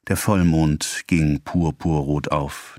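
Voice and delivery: plain reading voice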